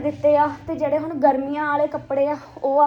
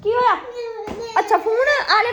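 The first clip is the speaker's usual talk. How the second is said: shouting out; 9 semitones higher than usual. Real, not acted. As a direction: call out what happened. Calling out to crying child.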